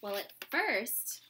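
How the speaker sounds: usual speaking voice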